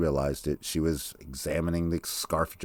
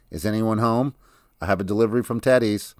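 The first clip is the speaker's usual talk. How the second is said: louder, faster call toward the back of the house; 5 semitones higher, 6 dB louder than usual. A narrator's reading. Zella calls around the house, delivering for Teddy's, louder calling. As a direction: call out quickly, louder than normal talk. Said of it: "she called".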